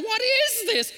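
preacher voicing brothers in a panic; mock panic. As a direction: play the brothers freaking out. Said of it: "Those brothers are freaking out".

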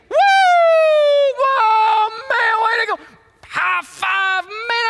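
high, loud whooping sports fan; acted-out ecstatic crowd shouts; celebrating fan. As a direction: cheer wildly as if your team just scored. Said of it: "have you ever noticed what people do".

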